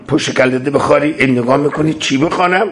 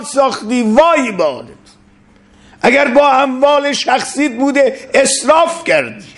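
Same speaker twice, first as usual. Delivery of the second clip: stern rebuke; 12 semitones higher than usual. Acted. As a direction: denounce the ruler from the pulpit. Abuzar rebukes Muawiya.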